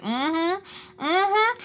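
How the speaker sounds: high rising mm-hmm; mock smug. Teller reacting to his being startled, mock smug.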